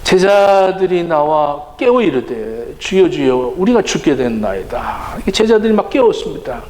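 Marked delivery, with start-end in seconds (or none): 2.8-5.2 low, restrained recital of the verse; subdued scripture reading. A narrator's reading. Preacher reads the disciples' cry from scripture, subdued reading. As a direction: read it solemnly, low and even. Verse read out before the acted version.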